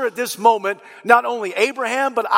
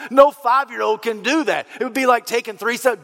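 normal talking voice

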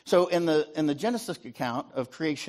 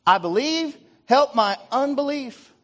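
loud, much higher, slow desperate plea; crying out; 11 semitones higher, 7 dB louder, slower than usual. Acted. Desperate father crying out to Jesus for help. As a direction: cry it out like a father begging for his son. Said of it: "the father and child cried out".

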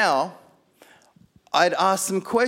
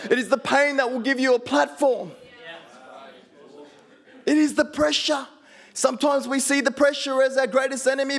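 full-force preaching; 9 semitones higher than usual. Acted. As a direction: preach it to the congregation with fire.

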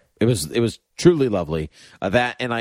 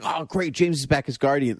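higher-pitched glad surprise; pleased excitement; 6 semitones higher than usual. Retold or imitated. Fan delighted to see James return as Guardian, upbeat. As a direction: welcome the news brightly, voice lifted. First reaction, before he spoke.

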